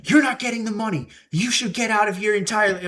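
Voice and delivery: hammed-up angry boss, fast and high; shouting boss. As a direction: throw him out like a furious boss. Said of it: "he just started shouting stuff".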